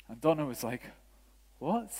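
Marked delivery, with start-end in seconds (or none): 1.6-1.9 high, rising mock astonishment; astonished. Wife reacting to words in tongues, imitated astonishment. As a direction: squeak it out as a baffled question.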